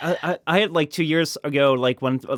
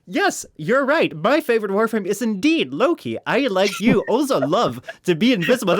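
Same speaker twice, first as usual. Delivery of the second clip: smug youtuber voice; 7 semitones higher, 4 dB louder than usual. Pretend. Video creator agreeing with the viewer, high and fawning.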